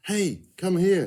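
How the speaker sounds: high, loud, drawn-out call across the ground; a boy shouting to his sister from a distance; boy yelling. Acted. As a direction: call him over from far off. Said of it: "he yelled a little while later".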